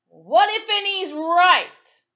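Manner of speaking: very high, loud, slow call from the crowd. Acted. A lone voice in the crowd calling out, very loud. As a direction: call it out over heads. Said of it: "a voice called out".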